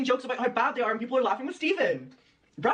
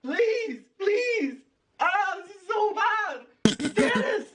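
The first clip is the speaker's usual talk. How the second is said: anguished cringe shout; 7 semitones higher, much slower than usual. Real, not acted. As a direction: cry out as if in pain. Cringing at terrible jokes.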